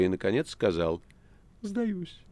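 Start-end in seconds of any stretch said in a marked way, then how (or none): 1.6-2.2 tiny high squeak of surrender; high-pitched, subdued cat; cat giving up. Acted. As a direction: concede in a high, small cat voice. Cat meekly gives up.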